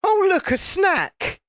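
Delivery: very high, slow cartoon gator; gator's voice. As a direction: play a gator spotting food. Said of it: "And then it would go".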